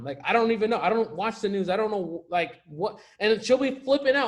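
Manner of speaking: normal talking voice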